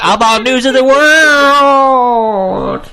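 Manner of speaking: slow, loud drawn-out announcer. Pretend. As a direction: boom it like a show host. Announcing the podcast segment.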